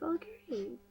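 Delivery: soft unvoiced okay; whispered okay. Josh answering his captor, whispered. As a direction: breathe the word out. Whisper inside retold quote.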